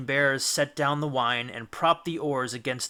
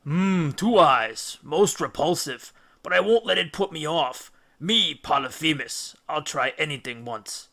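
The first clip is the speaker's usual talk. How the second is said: giant's voice; 3 dB louder than usual. Acted. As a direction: savour the idea of eating them. Cyclops eyeing the men.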